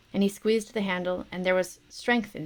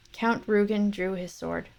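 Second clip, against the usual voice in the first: usual speaking voice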